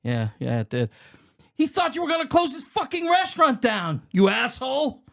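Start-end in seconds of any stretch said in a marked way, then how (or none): 1.5-5.0 high, heated outburst; worked-up high tirade; acted angry accusation. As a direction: hurl it out high and furious. Acts out angry monologue.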